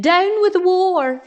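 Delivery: high, slow hooted chant; long-held, high dinosaur hoot. A narrator's reading. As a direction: hoot the slogan, drawing it out. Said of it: "And he hooted".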